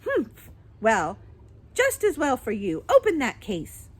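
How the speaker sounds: huff, then order her about; cross old mother. A narrator's reading. Voices cross Miss Corey.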